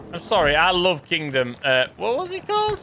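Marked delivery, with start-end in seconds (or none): 2.0-2.8 silly high voice. Pretend. Silly high voice while forgetting.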